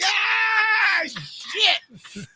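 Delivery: high, loud hurt cry; comic pained shout; mock pained yelp. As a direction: yelp like you got hit. Retold pain from the punch.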